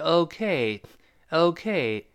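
'OK' with the second syllable heavier and drawn out; stress on K. Shown as a demonstration. Teacher explaining the stress, slow 'OK' weighted on K. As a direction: lean slowly on the 'K' of 'OK'. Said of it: "那个K其实更加重一点".

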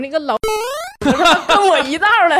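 high, loud laughing curse at the ghost; retold cursing. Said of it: "然后我就破口大骂". (边笑边骂鬼，声音又高又响)

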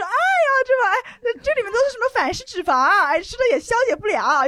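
high, loud mock-concerned sneer; snide sneer. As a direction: whine it like a know-it-all nag. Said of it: "这个人就很贱".

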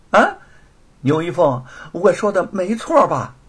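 loud, scornful challenge, high-pitched; sneering taunt. A narrator's reading. Thief daring accusers to show proof, sneering taunt. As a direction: throw the accusation back with a sneer. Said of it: "冷笑着说".

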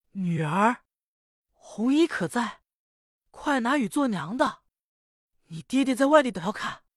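urgent mother. Acted. Mother hurries to daughter.

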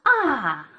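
a quick frightened 啊 that falls; startled falling ah. Shown as a demonstration. Teacher confirming the fourth tone with a startled ah. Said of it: "这个啊就是去声的".

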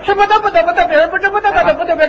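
rattled off fast in one breath; rapid tongue twister. Shown as a demonstration. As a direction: race through it without breathing. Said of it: "一口气儿我能说四十七个".

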